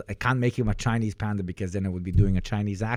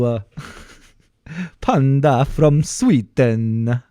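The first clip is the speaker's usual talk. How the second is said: slow, bouncing Swedish lilt; exaggerated slow Swedish singsong; Swedish accent; slower than usual. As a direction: copy a train-rhythm Swedish accent. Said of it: "how you do a Swedish accent".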